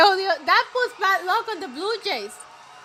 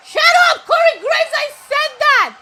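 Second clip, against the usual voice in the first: real annoyance, shouted at the TV; mad yell; 8 semitones higher, 8 dB louder than usual. Real, not acted. Shouting at Corey Graves for stealing his line, angry. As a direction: shout much higher and louder at the commentator. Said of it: "I got mad 'cause he copied my".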